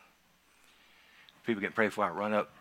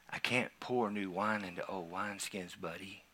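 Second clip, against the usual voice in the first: retold voice of the Lord; 3 semitones lower, 10 dB quieter than usual. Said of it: "he said it just like this out loud".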